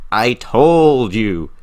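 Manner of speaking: loud, slow mock scolding; frustrated mother. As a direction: drag it out like an exasperated mom. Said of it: "but there's also frustration".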